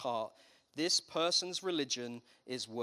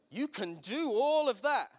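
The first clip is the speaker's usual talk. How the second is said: higher and louder, slowed for effect; emphatic punchline; 8 semitones higher, 6 dB louder, slower than usual. Real, not acted. Preacher driving home the point to the congregation, slow emphatic punch. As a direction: land the line hard and slow, raised and loud. Punchline after raised-hands joke.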